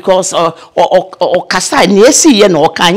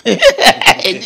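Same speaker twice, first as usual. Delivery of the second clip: a quick amused laugh, a bit higher; real laugh; 4 semitones higher than usual. Real, not acted. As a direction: let a laugh slip out at the story. Laughs at her own anecdote.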